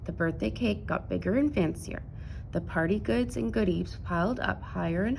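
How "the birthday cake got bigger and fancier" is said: ordinary delivery, nothing marked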